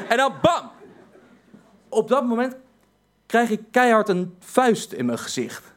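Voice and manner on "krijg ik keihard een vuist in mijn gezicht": usual speaking voice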